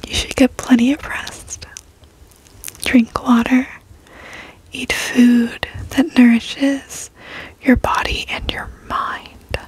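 ordinary talk, nothing marked

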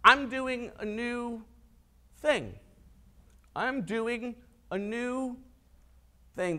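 slow, soft, measured answer; calm reply. Retold or imitated. Preacher voicing Jesus answering his accusers, calm. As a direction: answer quietly, with long pauses before the key word.

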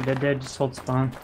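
usual speaking voice

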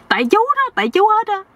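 very high, aggrieved acted voice; accusing. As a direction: blame him in a high-pitched complaint. Nephew blames the uncle. (giọng cao, trách móc)

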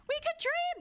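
high-pitched, playful cry; excited exclamation. Pretend. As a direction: burst out with mock-hopeful enthusiasm. Repeats it as an exclamation.

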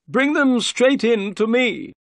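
loud, slow, very high call. A narrator's reading. Unseen master insists to his servant, calling out. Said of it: "the voice called".

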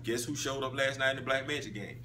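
ordinary talk, nothing marked

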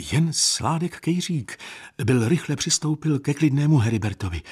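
usual speaking voice

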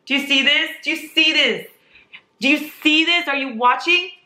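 high, urgent repeated demands to look; excited insisting. Real, not acted. Showing viewers the fan pictures, excited insisting. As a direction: pitch it high and press the viewers.

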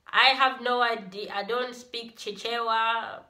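normal talking voice

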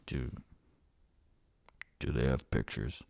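low, quiet character voice; sheepish Onyx. Acted. Customer asks whether books have pictures, low and sheepish. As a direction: ask it deep and soft, hesitating.

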